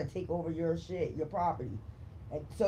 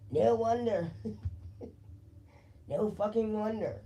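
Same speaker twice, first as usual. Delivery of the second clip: amused; 3 semitones higher than usual. Real, not acted. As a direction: laugh between the words, pitched up. Amused by card, laughs between phrases.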